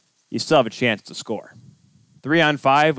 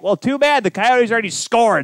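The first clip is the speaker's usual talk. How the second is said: mocking; 10 semitones higher, 4 dB louder than usual. Mock scolding the late viewer.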